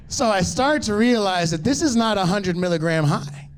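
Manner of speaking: normal talking voice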